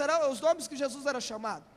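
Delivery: normal talking voice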